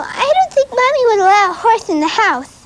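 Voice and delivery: put-on high lisping little girl; lisping Cindy voice. Shown as a demonstration. Actress demonstrating Cindy's lisp, high little-girl voice. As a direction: put th where the s goes, high and childlike. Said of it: "You put your T H's where your S's are".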